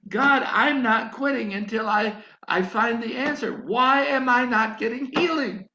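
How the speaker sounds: loud, insistent cry to God; desperate plea. Retold or imitated. Preacher demanding an answer from God, loud and desperate.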